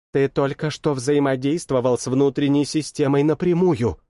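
usual speaking voice

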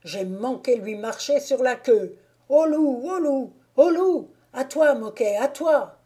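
cries building to high, loud yells; shouted alarm. A narrator's reading. Gamekeeper warning Moquet of the bolting wolf, shouting. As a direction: raise the alarm with urgent calls. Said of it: "cria tout à coup".

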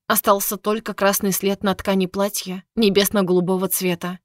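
usual speaking voice

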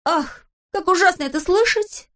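high horrified Pippi cry; exclaiming. A narrator's reading. Pippi moved by the countess's lament, exclaiming. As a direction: gasp it out in dismay. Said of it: "воскликнула Пеппи".

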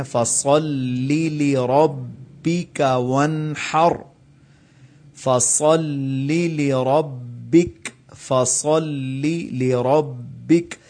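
فَصَلِّ لِرَبِّكَ flowing, both ل distinct; no pause, both lams. Shown as a demonstration. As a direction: link فَصَلِّ into لِرَبِّكَ slowly. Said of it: "فَصَلِّ پر ہم نے رکنا بھی نہیں ہے".

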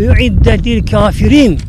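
slow, loud Quranic recitation; recited verse. Acted. Preacher reciting verse to congregation, slow and loud. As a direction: intone the verse slowly and loudly.

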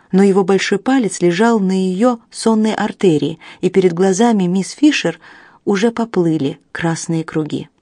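usual speaking voice

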